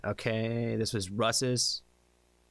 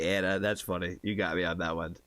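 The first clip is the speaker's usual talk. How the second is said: usual speaking voice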